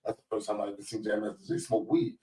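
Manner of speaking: ordinary talk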